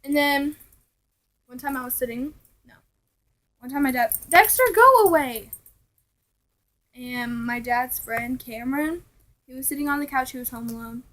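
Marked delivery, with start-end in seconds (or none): 4.3-5.5 calling out. Retold or imitated. Calling out at the dog.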